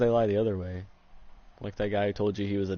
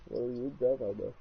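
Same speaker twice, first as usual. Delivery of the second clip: underwater; 5 dB quieter than usual. Real, not acted. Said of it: "You sound like you're underwater".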